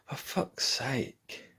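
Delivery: slow, soft, barely voiced groan of words; retold sleepy grumble, drawn out; weary exasperation. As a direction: drag it out softly, too tired to care. Tired, retold reaction in bed.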